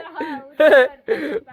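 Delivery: loud laughing fit. Real, not acted. Vlogger laughing at the homemade swing, loud laughing.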